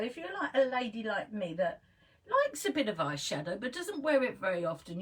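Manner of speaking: normal talking voice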